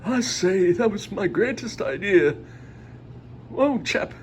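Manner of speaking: high-pitched, hammed-up British chap; British accent. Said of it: "That's my best British accent I can do".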